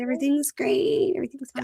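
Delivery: high, breezy denial; mock cheerful; fake cheerful. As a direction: brush it off brightly. Sarcastic mock voice continued.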